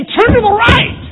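loud, high yelled order; shouted command. Pretend. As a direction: yell it like a harsh master. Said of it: "Or does he have to say".

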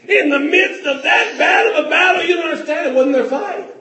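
loud, fast rising preacher's cry; fervent revival preaching; preaching at full force. Acted. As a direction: drive it home to the congregation. Preacher building intensity.

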